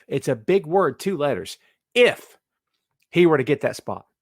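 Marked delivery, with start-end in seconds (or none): 1.9-2.4 "if" punched high and loud; stress on IF. Real, not acted. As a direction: hit the word "if" hard, on its own. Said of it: "emphasis on the word if".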